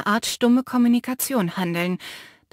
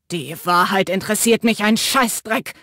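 spat, contemptuous and louder; 4 dB louder than usual. Acted. Matteo spits the words at Finn, contemptuous. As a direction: spit the words at him. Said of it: "spie Matteo aus".

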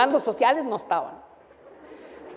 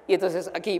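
normal talking voice

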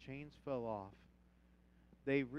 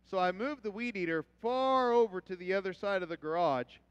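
loud, animated preacher's story; preaching storytelling; 5 semitones higher, 17 dB louder than usual. Acted. Pastor telling his congregation a loud boyhood story. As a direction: tell it big from the pulpit.

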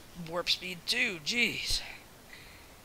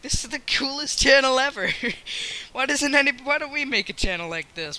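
higher, louder gushing; mock excitement; 9 semitones higher, 9 dB louder than usual. Recalling a reaction to a kids' channel, hammed-up excitement. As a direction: gush like an over-keen fan.

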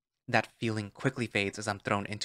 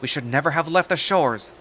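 loud, high complaining sailor; yelling complaint; 5 semitones higher, 7 dB louder than usual. Acted. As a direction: shout your grievance over the wind. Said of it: "Another sailor yells out among the crew".

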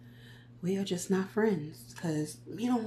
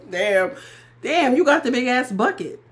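mock indignant; 5 semitones higher, 7 dB louder than usual. Mocking a popcorn moocher.

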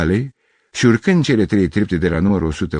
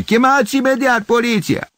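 very high, loud yell; sharp female shriek; shrill woman's voice; 5 dB louder than usual. A narrator's reading. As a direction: screech an urgent demand as a woman. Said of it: "o voce ascuțită de femeie".

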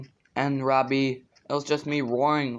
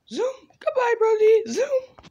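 put-on excited squeaky kid voice; 3 dB louder than usual.

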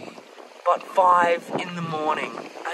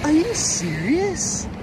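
disbelief; 9 semitones higher, slower than usual. Real, not acted. More shells found.

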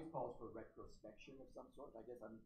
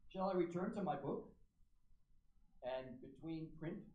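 louder, measured reading of a poem; solemn poem recital, louder than the talk; 4 dB louder than usual. Acted. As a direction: read each line out to the hall with weight. Reads R S Thomas poem aloud.

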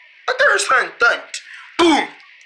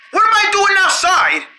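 startled outburst; 9 semitones higher than usual. Acted. Waking up outside, confused.

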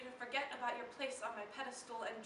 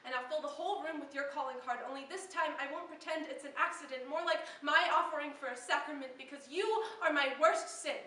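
spoken-word crescendo, punched at the end; impassioned climax; 6 dB louder than usual. Acted. Poet confessing the lover is their worst sin, impassioned crescendo. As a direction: build to a loud, forceful confession.

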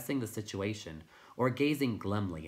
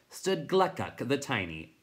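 ordinary delivery, nothing marked

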